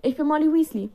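loud, high, effusive motherly witch; gushing Molly Weasley. Acted. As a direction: burst out in a loud high fluster. Molly greets Helena effusively.

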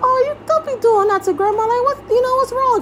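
put-on high-pitched parent-to-baby voice; talking to baby. Replays talking to upset baby.

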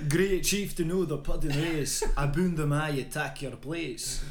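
loud, rolling Scots declamation; loud recital. Acted. Reciter shows friend a baffling Burns line, loud declamation. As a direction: proclaim the verse loudly. Quotes poem loudly amid laughter.

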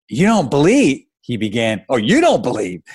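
an indignant father's incredulous question. Acted. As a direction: throw it back in disbelief. Stunned father challenges his daughter.

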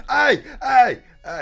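loud, high mock yelp of pain; mock pain cry. Host pretends needles hurt him, a loud high mock yelp. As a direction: wail ay ay ay as if the needle already hurts.

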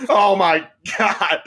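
high, slow laughing oh my God; laughing panic. Retold or imitated. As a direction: stretch it out through laughter.

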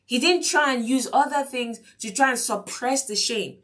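ordinary delivery

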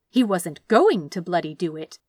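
ordinary delivery

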